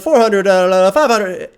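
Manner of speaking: fast loud auctioneer call. Retold or imitated. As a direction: rattle off numbers like an auctioneer. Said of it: "sounds like the auctioneer guy".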